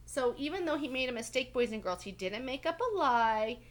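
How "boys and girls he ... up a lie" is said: ordinary delivery, nothing marked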